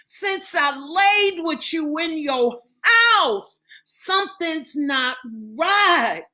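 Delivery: he's losing it, voice cracking; panicked outburst. Acted. Marcus panicking, failing again.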